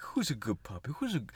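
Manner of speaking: hushed, low cooing to a puppy; whispery mock baby talk. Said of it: "talk to you like you're a baby".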